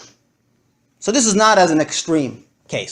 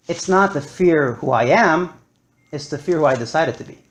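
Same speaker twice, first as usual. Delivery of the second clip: normal talking voice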